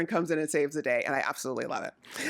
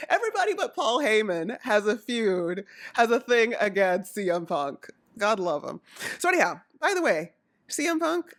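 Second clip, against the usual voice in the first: normal talking voice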